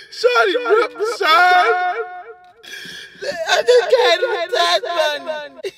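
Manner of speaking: high wailing shouted sobs; fake crying. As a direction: sob and yell about the tax money. Practicing a fake crying story.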